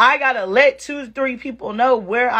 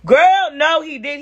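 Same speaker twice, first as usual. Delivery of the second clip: emphatic; 5 semitones higher, 3 dB louder than usual. Real, not acted. Telling friends, exclaiming.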